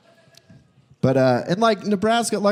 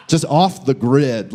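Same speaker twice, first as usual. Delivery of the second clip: normal talking voice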